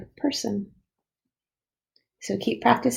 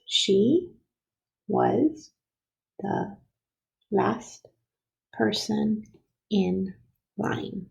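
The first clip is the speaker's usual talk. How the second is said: "She, was, the, last, person, in, line" with a pause after each word; each of "She was the last person in line" set apart; counting out words; much slower than usual. Shown as a demonstration. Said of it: "Let's count out the words".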